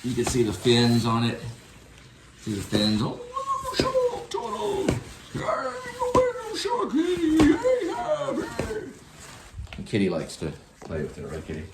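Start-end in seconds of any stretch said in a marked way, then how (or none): 3.0-8.8 falsetto toy voice. Pretend.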